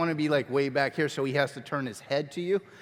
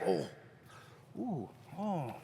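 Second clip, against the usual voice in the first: mock scared reaction; 14 dB quieter, slower than usual. Shown as a demonstration. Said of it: "you're like".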